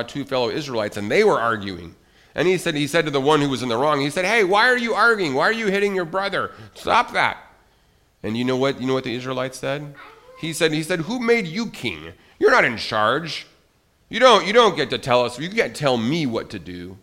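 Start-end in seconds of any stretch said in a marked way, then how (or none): 4.2-7.4 higher, louder stern call to stop; hey-stop-that telling off; scolding shout. Acted. Moses scolds arguing Israelites. 11.0-17.0 defiant retort. Acted. Israelite talks back defiantly.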